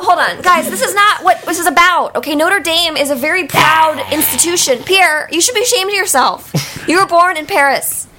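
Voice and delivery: worked-up, indignant telling-off; exasperated scolding. Acted. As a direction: cut in and scold them firmly. Chancellor scolds Pierre and the room.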